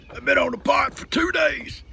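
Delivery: high, loud, pitiful wail; imitating sick Doc. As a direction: complain like a man stuck on the toilet. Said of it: "try to sound exactly like he sounded".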